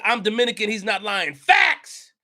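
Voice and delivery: usual speaking voice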